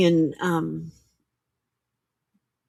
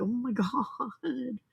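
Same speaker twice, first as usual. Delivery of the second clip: teary; 4 semitones higher, 9 dB quieter, much slower than usual. Retold or imitated. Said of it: "I was crying. I'm like".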